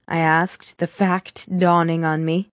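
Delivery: ordinary delivery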